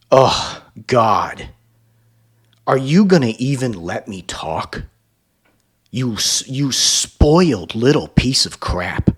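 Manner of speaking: hammed-up scornful outburst; mock narcissist tantrum.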